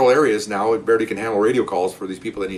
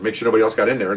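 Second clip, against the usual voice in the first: ordinary delivery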